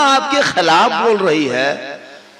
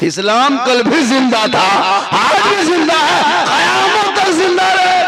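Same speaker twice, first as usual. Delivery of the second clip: full-force preaching; 5 semitones higher, 4 dB louder than usual. Real, not acted. Preacher rallying the crowd for Islam, full-force proclamation. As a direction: build higher and louder to a held peak.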